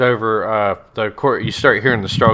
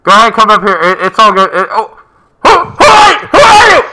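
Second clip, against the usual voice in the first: high, loud squeaky little voice; tiny creature shrieking in alarm; pixie voice; 10 semitones higher, 10 dB louder than usual. Acted. Little voice behind door.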